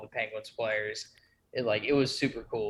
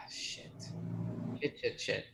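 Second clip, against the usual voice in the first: breathy under-the-breath swearing; soft worried curses; whispered cursing; 3 semitones lower, 11 dB quieter than usual. Real, not acted. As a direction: mutter it to yourself in dismay. Stressed about his draft pick.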